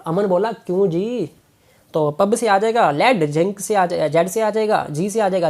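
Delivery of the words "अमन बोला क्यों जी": ordinary talk, nothing marked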